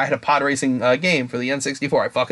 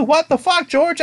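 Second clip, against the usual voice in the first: high, incredulous fan jab at George; mock fan outrage; 14 semitones higher, slower than usual. Fan griping at George Lucas about episode two, high mock outrage. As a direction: throw it up high like a fed-up fan. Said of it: "Episode two".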